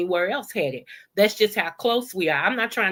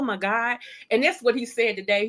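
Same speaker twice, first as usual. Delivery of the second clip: normal talking voice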